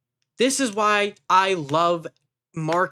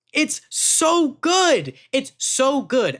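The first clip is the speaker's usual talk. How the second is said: high, drawn-out, louder gush of delight; gushing excitement; 7 semitones higher, 3 dB louder, much slower than usual. Real, not acted. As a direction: stretch it out like you can't contain it. Gushing over favourite scene.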